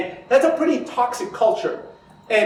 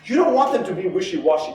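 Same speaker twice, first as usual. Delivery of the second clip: usual speaking voice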